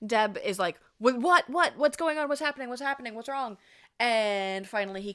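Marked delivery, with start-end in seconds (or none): 1.0-3.6 high, rapid-fire worried questions; acted alarm. Storyteller voicing Deb answering her panicking husband, urgent mock alarm. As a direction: start loud and tumble through the questions.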